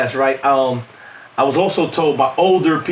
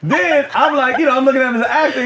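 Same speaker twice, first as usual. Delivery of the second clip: laughing; 7 semitones higher than usual. Real, not acted. Laughing, rushing through the story.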